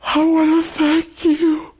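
high, slow, tearful-sounding thank you; grateful woman. Retold or imitated. Woman thanks him after healing.

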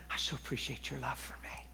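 intimate whisper of thanks; whispered prayer. Retold or imitated. Speaker whispering love to the Holy Spirit, whispered. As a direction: breathe the words like a secret prayer.

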